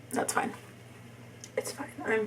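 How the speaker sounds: hushed self-reassurance under breath; whispering. Real, not acted. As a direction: whisper to herself, calming down. Mess while doing makeup.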